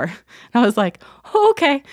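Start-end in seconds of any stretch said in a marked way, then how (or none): 1.3-1.8 high, loud, startled acceptance; overwhelmed okay. Retold or imitated. Patient to doctor on hearing bad levels, overwhelmed. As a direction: reenact taking bad news in a high squeak. Reenacts reacting to bad news.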